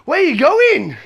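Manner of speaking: high, loud, over-friendly question; hammed-up cheery opener; ingratiating small talk. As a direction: imitate a traveler forcing chirpy small talk. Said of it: "I say the thing that you always say".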